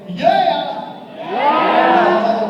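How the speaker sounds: big rising shout to the crowd; loud cheer. Real, not acted. Comedian hyping the audience, big loud yeah. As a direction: pump up the audience. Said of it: "Who's ready to say".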